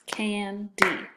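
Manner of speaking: "candy" as can, pause, dee; split into syllables. Shown as a demonstration. Teacher showing two vowel sounds, "candy" split into can and dee. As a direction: break "candy" into its two beats. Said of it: "It has two vowel sounds".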